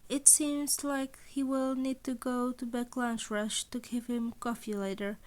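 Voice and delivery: ordinary delivery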